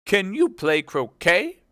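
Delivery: high, loud, slow question; shouting queen. Acted. Queen questioning Alice about croquet, loud shout. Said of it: "shouted the queen".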